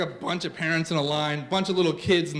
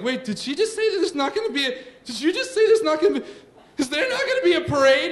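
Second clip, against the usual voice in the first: put-on high, rushed kid questioning; fast, rising, incredulous child voice; alarmed kids; 8 semitones higher, faster than usual. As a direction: stammer it out like disbelieving children. Worried kids question dad about parade.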